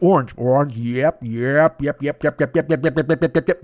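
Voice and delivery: light rapid yep-yep puppet chatter; a hint of alien puppets yipping; alien yip-yip talk. Imitating the aliens finding an orange, light yipping. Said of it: "that's how they talked".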